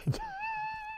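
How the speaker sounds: a burst of high-pitched laughter; high laughing shriek. Real, not acted. Audience roaring laughter.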